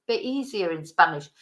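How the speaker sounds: ordinary talk, nothing marked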